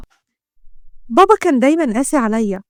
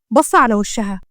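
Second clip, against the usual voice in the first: ordinary delivery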